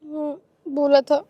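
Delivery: low, slow, sheepish reply; small, guilty answer; reluctant admission. Acted. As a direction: admit it unwillingly to mother. Daughter admits under questioning.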